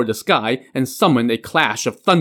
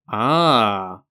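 sudden understanding, let it out; realizing ah. A narrator's reading. Jiang Ziya reads the note and realizes, drawn-out ah.

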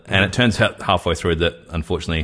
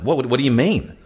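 higher, stammered bewilderment; retold alarm; 7 semitones higher than usual. Retelling his answer to the threatening caller, acted alarm. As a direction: act flustered, pitch jumping up. Retold panicked reply, freaking out.

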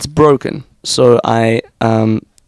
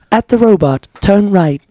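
higher, robotic sat-nav announcement; GPS voice; 8 semitones higher than usual. Retold or imitated. A guest imitates the South African GPS voice. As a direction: read it like a car navigation prompt. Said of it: "even the G P S would say".

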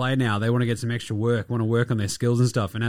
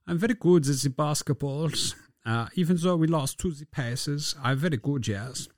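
mock German accent; 4 semitones higher, 3 dB quieter than usual. Voicing Franz Wagner after loss.

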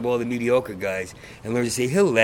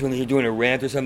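ordinary talk

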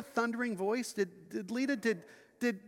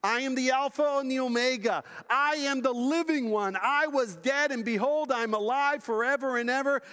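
higher, louder proclamation of Christ's words; preaching at full force; 5 semitones higher, 4 dB louder than usual. Acted. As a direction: declare each "I am" with pulpit power. Voicing Jesus in sermon.

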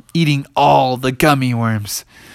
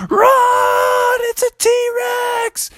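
very high, drawn-out scream; hammed-up shriek of terror; screaming panic; much slower than usual. A narrator's reading. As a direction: scream a warning like fleeing townsfolk. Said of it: "started running and screaming".

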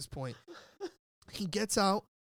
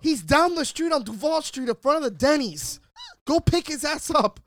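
furious shout; 8 semitones higher, 9 dB louder than usual. Retold or imitated. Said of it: "He goes".

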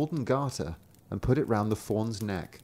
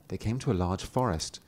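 ordinary delivery, nothing marked

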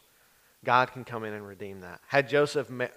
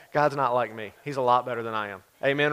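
ordinary delivery

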